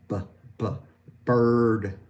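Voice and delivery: b sounds then bird with held R; b to bird demo. Shown as a demonstration. Teacher building bird from b sound. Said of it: "Now the B sound".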